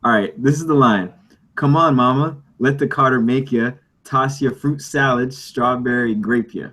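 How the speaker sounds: usual speaking voice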